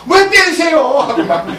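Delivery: high, loud hurt cry of the beaten donkey; whining donkey. Acted. Donkey complains to Balaam.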